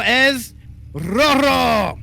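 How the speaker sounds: stretched, high announcer call on rojo; lucha libre announcer. Acted. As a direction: drag out es rojo slowly like a ring announcer. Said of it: "should we go in Spanish".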